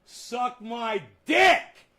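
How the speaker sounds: slow, high, loud insult shout; mocking shouted taunt. Real, not acted. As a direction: yell it at the troll, drawn out. Taunting a troll on stream.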